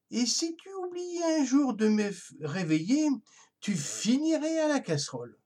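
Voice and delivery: lightly voiced baron warning of the pot; mock-threatening baron. A narrator's reading.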